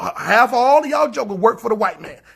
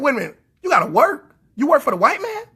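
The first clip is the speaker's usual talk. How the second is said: mock disbelief; 5 semitones higher, faster than usual. Retelling it to the brother, mock-incredulous surprise. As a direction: pitch jumps up on the incredulous question.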